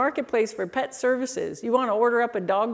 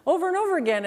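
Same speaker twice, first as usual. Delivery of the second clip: ordinary talk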